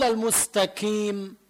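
المستقيم said "al-mustakiim"; wrong k for ق. Shown as a demonstration. Teacher showing the mistake, المستقيم with kaf for ق. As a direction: front the ق of المستقيم into a k. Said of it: "عملت كاف".

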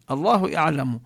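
ordinary delivery

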